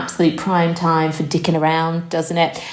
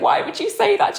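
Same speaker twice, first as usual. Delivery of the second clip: alarmed question. Retold or imitated. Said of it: "I was like".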